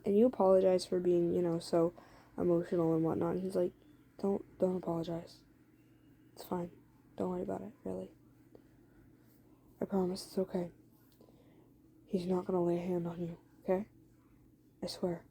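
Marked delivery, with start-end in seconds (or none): 4.2-15.2 gentle, soft reassurance to a crying friend; soft reassuring. Acted. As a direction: hush her worries kindly and softly. Eddie comforting crying girlfriend.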